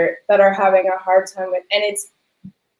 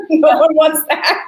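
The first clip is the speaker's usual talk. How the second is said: laughing it off, pitch jumping up; amused laughing brush-off; 9 semitones higher than usual. Real, not acted. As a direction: laugh through the words. Laughing at joke about teaching.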